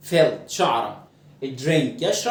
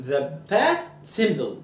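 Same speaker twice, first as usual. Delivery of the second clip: the past simple said very slowly; slow term; much slower than usual. Shown as a demonstration. Teacher names the tense slowly for students. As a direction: give the term word by word. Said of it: "زمن الماضي البسيط".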